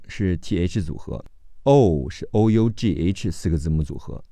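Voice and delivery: ordinary talk, nothing marked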